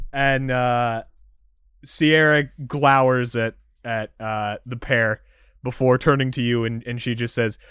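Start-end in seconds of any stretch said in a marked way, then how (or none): none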